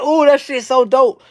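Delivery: high, loud excited cousin voice; cousin impressed. Retold or imitated. Cousin copying his lingo, high and loudly delighted. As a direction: squeal it like she's delighted. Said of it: "She's like".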